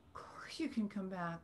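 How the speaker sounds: soft, whispered welcome; hushed, tender reply; tender near-whisper. Retold or imitated. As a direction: breathe it out softly to a returning child. Mother answers son asking to return.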